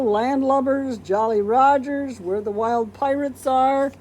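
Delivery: ordinary delivery, nothing marked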